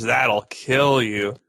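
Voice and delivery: ordinary talk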